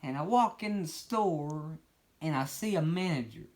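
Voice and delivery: high, slow put-on storyteller voice; character voice. Newcomer walking into the bloody store, high and slow. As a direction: stroll in slow and high, clueless. New narrator walks into store.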